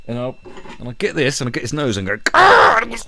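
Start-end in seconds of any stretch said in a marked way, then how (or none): 2.3-2.8 very high, loud yelp of pain; cartoonish scream; mock pained scream. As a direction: shriek like your nose is being grabbed. Acting the friend's pain.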